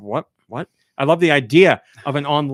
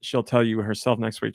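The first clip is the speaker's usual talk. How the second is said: ordinary talk, nothing marked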